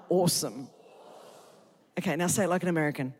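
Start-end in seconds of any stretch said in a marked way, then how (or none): 0.1-0.7 low-pitched Australian "awesome"; Australian accent. Shown as a demonstration. Preacher drilling Americans one more time, Australian accent. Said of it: "One more time".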